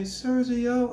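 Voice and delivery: put-on timid boy voice. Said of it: "they talk like that".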